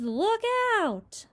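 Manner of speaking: high alarmed shout, falling at the end; cried warning. Acted. James cries out as centipede topples, alarmed shout. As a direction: shout it as he goes over. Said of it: "cried James".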